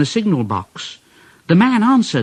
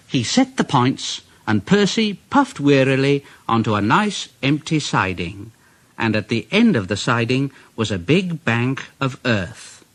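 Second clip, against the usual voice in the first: plain reading voice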